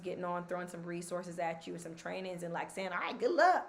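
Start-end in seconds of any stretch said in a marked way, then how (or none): 2.9-3.7 dismissive. Pretend. Mocking a dismissive course creator.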